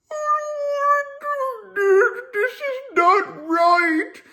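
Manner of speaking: high, slow, tearful mock sob; imitating crying. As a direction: whimper it out like a scared inmate near tears. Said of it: "and he's starting to cry".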